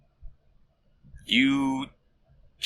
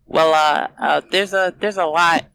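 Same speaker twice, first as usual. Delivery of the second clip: high, squeaky nervous rat; 9 semitones higher, 4 dB louder than usual. Acted. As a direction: squeak it out like a scared little rat. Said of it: "I'm excited for Rat Voice".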